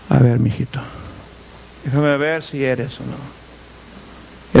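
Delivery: put-on low, slow, doubtful old man; blind old father. Preacher voices blind Isaac testing.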